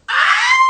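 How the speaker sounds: very high, loud scream; startled shriek. Real, not acted. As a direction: shriek suddenly in fright. Startled by the alligator box.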